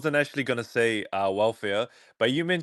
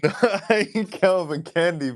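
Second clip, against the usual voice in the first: high, slow, laughing through the words; chuckling as he denies it; laughing denial; 7 semitones higher, slower than usual. Real, not acted.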